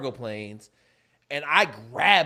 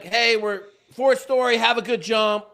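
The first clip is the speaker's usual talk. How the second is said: raised, higher announcing voice; brisk briefing; 5 semitones higher, 6 dB louder than usual. Retold or imitated. Briefer sending jumpers off, loud and brisk. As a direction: rattle off a brief to the troops.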